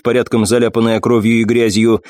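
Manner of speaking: ordinary delivery, nothing marked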